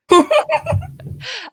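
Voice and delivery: high, loud burst of laughter; loud disbelieving laugh. Real, not acted. Player seeing an identical dice roll, disbelieving laughter.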